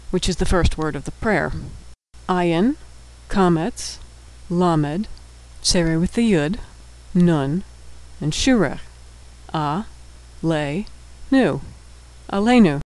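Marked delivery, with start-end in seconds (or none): 2.3-12.8 letter by letter, then syllable by syllable; sounding out. Shown as a demonstration. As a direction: spell it out with pauses, then say the whole word. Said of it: "we'll be sounding out all of the words".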